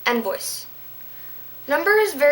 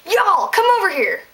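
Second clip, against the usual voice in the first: called out high and louder; calling out; 8 semitones higher, 5 dB louder than usual. Shown as a demonstration. Teacher demos calling a group over.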